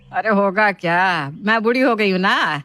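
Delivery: a touch of slow, drawn-out grievance; aggrieved mother-in-law. Acted. Mother-in-law complaining of disrespect, mildly aggrieved. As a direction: lightly play a sulky elder.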